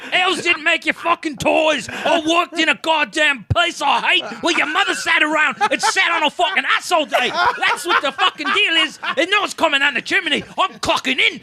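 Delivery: high mock yell of a bitter drunk father; hammed-up drunk father rant to his kids; angry drunk dad. Ranting drunk dad at Christmas.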